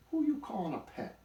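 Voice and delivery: high, loud comeback; indignant retort. A narrator's reading. Donkey insulted by the buzzard, high and indignant. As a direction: take loud offence.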